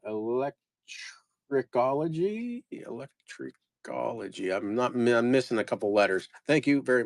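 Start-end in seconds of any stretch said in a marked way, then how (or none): 0.0-2.6 slow, higher, drawn-out questioning read; puzzled sounding out. Real, not acted. Seller decoding a buyer's name, slow puzzled question. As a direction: sound out the strange word like a question. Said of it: "Let me, let me figure this out".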